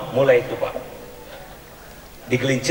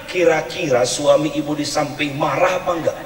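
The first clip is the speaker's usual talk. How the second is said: normal talking voice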